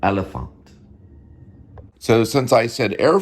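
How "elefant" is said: "Elephant" as el-eh-fahnt; German E. Shown as a demonstration. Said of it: "how you can get the E".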